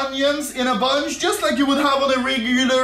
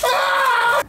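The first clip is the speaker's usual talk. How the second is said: startled yelp; 14 semitones higher than usual. Real, not acted. Dough sticks to his hand.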